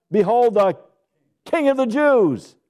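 loud, high, slow proclamation; KING hit hard and held; proclaimed shout. Acted. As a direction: ring it out over the crowd. Preacher proclaiming the sign's words.

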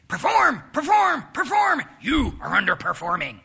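high, loud, barking demand to perform; nagging voice shouting. Retold or imitated. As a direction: yell it like a relentless taskmaster. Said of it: "this nagging voice that says".

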